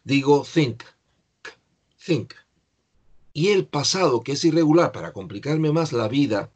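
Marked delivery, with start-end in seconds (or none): 0.4-2.3 "think" closed with a separate crisp K; "think" with a K that cannot be taken for "thing"; K clearly sounded. Shown as a demonstration. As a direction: release the final K of "think". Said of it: "para que sepa que yo lo que estoy pensando".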